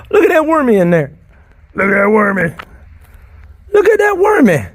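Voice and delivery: thrilled to spot a worm; gasping loud delight at the worm; excited gasp. Real, not acted.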